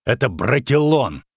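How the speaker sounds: emphatic scolding declaration; angry warning. A narrator's reading. Witcher warning the runaway girl, angry. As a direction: drive the forest's name home sternly.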